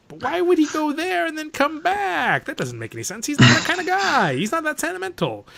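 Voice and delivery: high-pitched exasperated objection; incredulous reasoning. Retold or imitated. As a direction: protest in a high, disbelieving squeal. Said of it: "I'm like".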